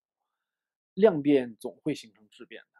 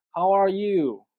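loud, higher-pitched rote greeting; stock textbook greeting; 6 semitones higher, 8 dB louder, much slower than usual. Shown as a demonstration. Teacher demonstrating a hello on meeting, loud stock greeting. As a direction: recite it like a worn-out textbook dialogue. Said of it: "这个是老套路啊".

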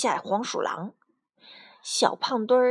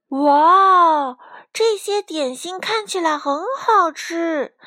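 loud, high wow of wonder; amazed exclamation; 7 semitones higher, 8 dB louder, slower than usual. A narrator's reading. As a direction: marvel aloud at a treat. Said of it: "卡门惊奇地喊道". (惊奇地高声喊)

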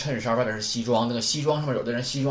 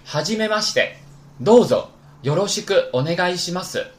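loud, higher teacher model reading; model Japanese phrase; 5 semitones higher, 6 dB louder than usual. Shown as a demonstration. Teacher modelling a greeting for the class, loud and clear. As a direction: read it out crisply for students to copy. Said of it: "表示初次见面，请多关照".

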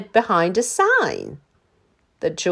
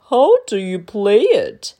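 a lightly voiced curious dinosaur; puzzled dinosaur; 7 dB louder than usual. A narrator's reading. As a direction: ask like a gentle giant. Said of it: "said the dinosaur".

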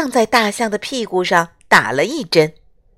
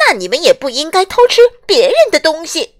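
shouting; 8 semitones higher, 5 dB louder, faster than usual. Acted. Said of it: "大叫道".

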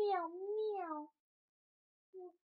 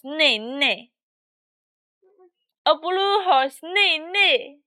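much louder sing-song; 16 dB louder than usual. Acted. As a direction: call out the animals loudly. Loud repeated animal calls.

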